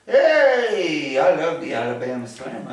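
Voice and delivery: loud, high, fast hype for the name; excited shout-out. Real, not acted. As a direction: cheer the fan's name.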